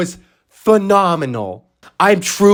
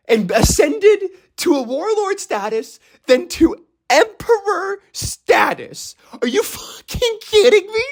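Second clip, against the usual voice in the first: slow climb into a very high squeal; mounting disbelief; 11 semitones higher than usual. Pretend. Fan marveling at Buggy's rise to emperor, mounting disbelief. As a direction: stretch each step higher, ending incredulous.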